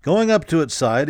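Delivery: ordinary delivery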